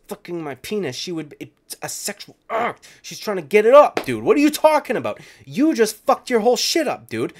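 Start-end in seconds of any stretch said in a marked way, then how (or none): 3.0-7.3 higher and louder, disbelieving; incredulous yelling. Real, not acted. Mocking the tweeter's careless wording, incredulous yell.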